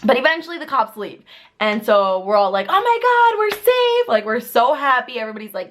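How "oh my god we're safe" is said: high, loud relieved squeal. Retold or imitated. Relief after cops leave.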